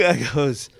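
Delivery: ordinary talk, nothing marked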